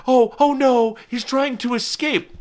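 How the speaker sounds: high-pitched mock dismay; hammed-up, high alarmed cry. Imitating alarmed onlookers watching a bird.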